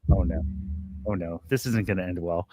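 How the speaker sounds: low, muttered mock dread; low mock groan of worry. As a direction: sink into a half-joking sense of doom. Retold inner panic at border.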